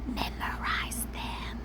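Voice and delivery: slow whispered instruction; hushed slow reminder. Acted. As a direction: whisper it slowly like a secret. Pep talk to past self.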